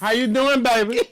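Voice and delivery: high, playful come-on; flirty greeting. Acted. Greeting a partner at the airport, flirty. Repeats acted pickup greeting.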